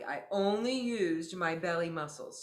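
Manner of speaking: ordinary talk, nothing marked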